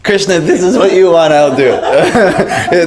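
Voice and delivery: high, laughing surrender, drawn out slowly at the end. Retold or imitated. Dancing with devotees, laughing as he gives in to Krishna. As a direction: give in through a laugh.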